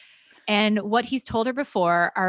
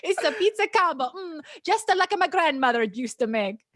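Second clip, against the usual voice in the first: put-on thick Italian accent; 8 semitones higher than usual. A narrator's reading. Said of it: "he says with a thick accent".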